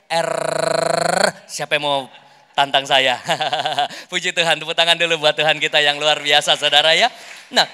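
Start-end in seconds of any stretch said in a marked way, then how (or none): 0.1-1.3 R trilled loud and crisp; rolled R. Shown as a demonstration. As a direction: proudly roll the R out. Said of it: "Tuhan jamah bibir saya, lidah saya".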